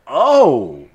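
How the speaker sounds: sudden loud surprised gasp; startled realization, loud and high. Retold or imitated. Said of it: "you're like".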